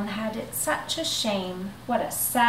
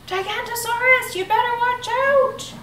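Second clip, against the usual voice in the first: high, loud alarm call; shouted warning; 14 semitones higher, 4 dB louder than usual. Acted. Bonehead shouting a warning down the hill, high and loud. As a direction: yell it out like a lookout. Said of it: "they heard a shout".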